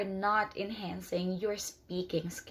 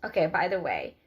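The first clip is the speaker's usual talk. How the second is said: ordinary talk, nothing marked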